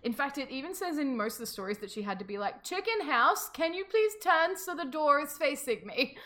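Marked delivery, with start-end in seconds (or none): 2.6-6.2 high, slow, measured request; polite summons. Acted. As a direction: call to the house, drawing the words out. Voicing a command to house.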